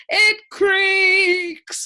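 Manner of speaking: very high, loud bellow. A narrator's reading. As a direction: bellow it with glee. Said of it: "bellowed the din".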